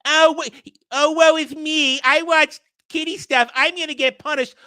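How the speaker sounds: mock a crybaby wailing over nothing; mock whining. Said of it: "Cloud is crying like a little bitch".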